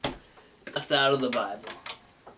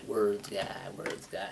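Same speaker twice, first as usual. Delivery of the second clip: fading repeats; 4 semitones lower, 8 dB quieter, slower than usual. Shown as a demonstration. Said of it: "Even I got a stutter".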